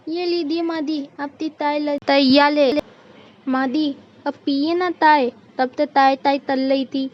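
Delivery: put-on babyish lisp, coaxing; eager childlike bahu; lisping daughter-in-law. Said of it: "अपने जैसी तोतली".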